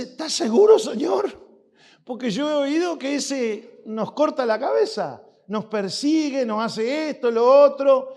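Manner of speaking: high, loud worried questioning; anxious Ananias. Acted. Ananias anxiously questions the Lord, high and loud. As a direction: plead your doubts to God.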